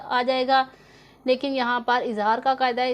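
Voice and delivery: ordinary delivery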